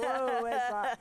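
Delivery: loud startled whoa; hammed-up confused exclamation; mock surprise. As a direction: act thrown by a strange spelling. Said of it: "I'm like".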